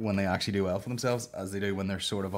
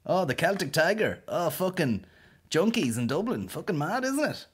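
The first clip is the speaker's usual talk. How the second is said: mocking Dublin voice; 8 semitones higher than usual. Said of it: "you're like".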